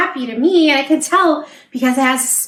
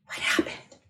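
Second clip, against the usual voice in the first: gasped whisper; 13 dB quieter than usual. Acted. Reading suspense to a child, gasp and whisper. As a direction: gasp then a soft whisper. Gasp then hushed question.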